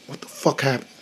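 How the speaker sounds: very soft, stunned murmur; dazed whisper. Retold or imitated. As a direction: breathe it out in shock. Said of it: "I'm like".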